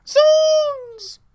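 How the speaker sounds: high, drawn-out comic shout; demonstrating a full-force, over-the-top yell; very loud yell. Shown as a demonstration. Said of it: "It needed to be like".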